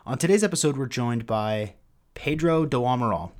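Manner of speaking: usual speaking voice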